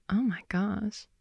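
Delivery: ordinary talk, nothing marked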